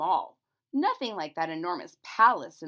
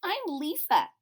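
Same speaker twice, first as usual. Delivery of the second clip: put-on little girl voice; 8 semitones higher, 6 dB louder than usual. A narrator's reading. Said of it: "she said".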